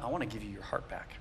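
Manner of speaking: Jesus answering the man who wants his legs; tender Jesus. Retold or imitated. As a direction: reassure him gently. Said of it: "And he goes".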